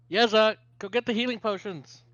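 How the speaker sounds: put-on loud, high call to a child; yelling over shoulder. Shopkeeper sends their kid for potions, yelling over shoulder. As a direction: holler for the kid in the back. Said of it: "[name] kinda yells over his shoulder".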